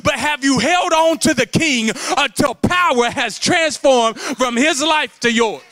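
loud, high, driving preacher's cry; full-force preaching. Acted. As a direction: preach it hard to the whole church.

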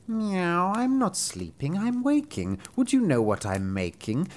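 high, meowing cat maid. Acted. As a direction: purr it like a housecat offering beer. Narrator voices the cat maid.

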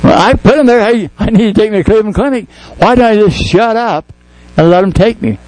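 high, urgent preaching voice; impassioned preacher building to a climax; fervent preaching. Real, not acted.

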